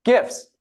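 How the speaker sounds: "gifs" repeated high, with a hard g; hard-g pronunciation. Shown as a demonstration. Host repeats the panelist's answer, "gifs" with hard g. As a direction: echo "gifs" high, starting like "gift". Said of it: "How is it pronounced?".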